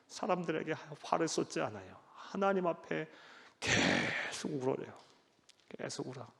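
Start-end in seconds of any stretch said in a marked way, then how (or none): 3.6-6.3 hushed. Acted. Jeremiah weeping before God.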